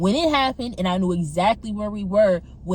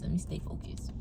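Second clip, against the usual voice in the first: breathy whisper, no voice at all; whispered to herself; 7 semitones lower, 13 dB quieter than usual. Real, not acted. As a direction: whisper it to calm yourself down.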